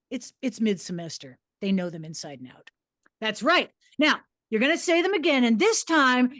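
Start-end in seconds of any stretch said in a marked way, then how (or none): none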